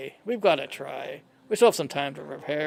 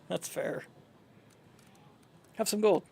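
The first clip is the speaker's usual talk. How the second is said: ordinary delivery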